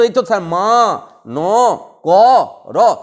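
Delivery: মা ন ক র stretched out slowly, one sound at a time; mock student spelling মা ন ক র out in broken pieces; a student's slow, chopped-up মা ন ক র; split syllable by syllable. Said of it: "তুই তো বলবি".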